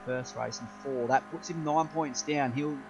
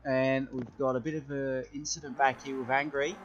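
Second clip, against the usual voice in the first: motorsport commentator; 10 dB louder than usual. Acted. Live race play-by-play.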